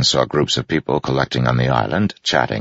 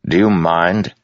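stern rebuke; 6 semitones higher, 4 dB louder than usual. A narrator's reading. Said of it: "Dalinar said sternly".

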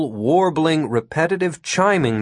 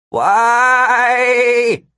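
high, loud bleating wail held on one note; goat-like wavering whine; 12 semitones higher, 6 dB louder than usual. A narrator's reading. Helpless Gary whining as he hangs vibrating in the air, goat-like waver. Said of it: "whined in a goat-like wavering tone".

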